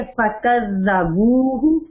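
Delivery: فکذبوہ very slow, ذ soft with no echo; soft dhal. Shown as a demonstration. Teacher models soft dhal for student. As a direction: draw out فکذبوہ gently, no bounce on ذ. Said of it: "اب دیکھیں آپ کو آ رہی ہے".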